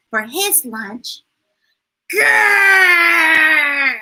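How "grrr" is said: loud hammed-up grrr, shouted; mock tiger growl. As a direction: roar as fiercely as a tiny mouse can. Mouse growls to prove he's tiger.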